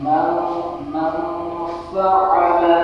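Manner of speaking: "মান ফাআলা" with the ন hummed through the nose and "ফাআলা" stretched; nasal ghunna recitation. Shown as a demonstration. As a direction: hide the ন of "মান" in a nasal hum. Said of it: "তাহলে উচ্চারণ হবে".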